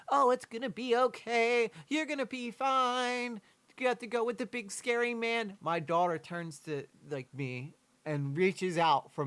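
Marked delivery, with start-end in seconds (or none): 0.1-5.6 much higher, sing-song mock of the family coaxing the child; mocking relatives' sweet talk. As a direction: parody their fake reassurance, pitched up.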